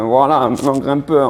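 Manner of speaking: put-on reluctant grumble; reluctant groaning. Coach mimicking a discouraged trainee facing climbers, grumbling. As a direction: groan through it as if burdened. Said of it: "l'attitude mentale, tu vois, et le comportement, c'est pas".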